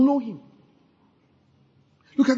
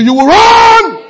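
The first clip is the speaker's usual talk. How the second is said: high, loud, slow shout of run; 10 semitones higher, 6 dB louder, slower than usual. Acted. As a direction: belt it out from the pulpit. Preacher shouting climax.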